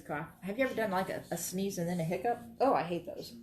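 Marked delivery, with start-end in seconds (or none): none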